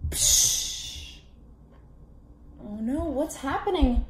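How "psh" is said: splash noise. Acted. Splash sound effect.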